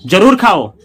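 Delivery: जरूर खाओ with जरूर loud and forceful; emphatic command. Shown as a demonstration. Teacher demonstrating an emphatic order, जरूर stressed loudly. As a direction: press hard on जरूर before खाओ. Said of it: "देख रहे हैं अंतर".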